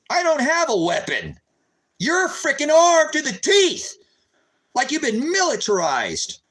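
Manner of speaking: indignant. Real, not acted. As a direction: spit out the accusation. Indignant rant at cops.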